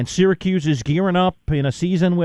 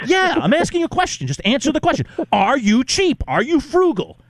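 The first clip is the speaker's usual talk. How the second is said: pitched-up, pushy grilling of a friend; higher-pitched playful badgering, keep pressing; mock interrogation; 8 semitones higher than usual. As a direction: hammer the question like a mock prosecutor. Host grilling cohost on air.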